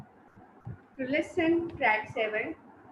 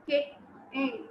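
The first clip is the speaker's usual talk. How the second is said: "kick, in" as two separate beats; diviso in sillabe; 5 dB quieter than usual. Shown as a demonstration. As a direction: split the word: KICK, short pause, IN. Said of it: "The word we divide into a sound".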